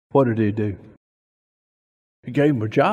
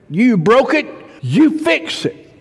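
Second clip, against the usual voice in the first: parent's rule; 11 semitones higher, 4 dB louder than usual. Retold or imitated. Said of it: "they said".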